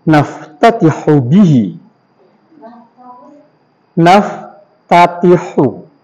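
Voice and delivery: very slow, drawn-out Arabic dictation. Shown as a demonstration. Teacher dictating an Arabic sentence for students to write down. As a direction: stretch every syllable so students can write. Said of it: "ulangnya aja terus".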